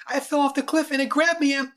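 put-on high, loud, insistent little girl; pleading child. A narrator's reading. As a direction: plead high and urgent until cut off. Girl pleading, gets cut off.

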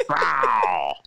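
drawn-out falling "rawr" growl; mock villain growl at someone running away; imitated growl. Co-host imitating the movie villain growling at her, mock growl. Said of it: "He totally growled at her".

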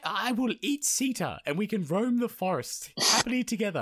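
high put-on demoness voice. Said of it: "she's like".

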